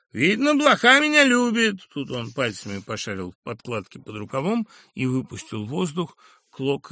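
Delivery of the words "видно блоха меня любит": high, loud, cocky and aggrieved; a brash lout talking back; crude upstart voice. Acted. Brash Sharikov talks back to the professor, high and loud.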